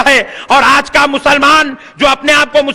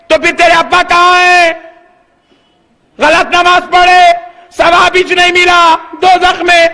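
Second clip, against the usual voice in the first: raised-pitch, ringing challenge; forceful preaching; 5 semitones higher than usual. Acted. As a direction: press each question home like a preacher. (پرجوش خطیبانہ انداز)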